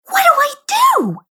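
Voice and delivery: squeaky high panicked yelp. Acted. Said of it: "she yelped".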